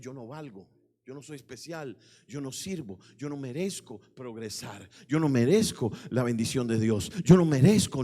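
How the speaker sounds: acted despair swelling in volume; building self-condemnation. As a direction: start low and restrained, build to a very loud cry. Acted inner voice of worthlessness, building.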